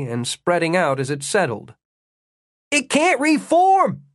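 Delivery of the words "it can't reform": high, urgent yell to the others. A narrator's reading. Jimmy to the others about the elemental, yelling. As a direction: shout it out slowly, pitched way up. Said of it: "he yelled to the others".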